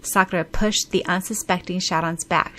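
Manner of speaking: plain reading voice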